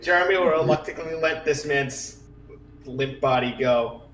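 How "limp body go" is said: usual speaking voice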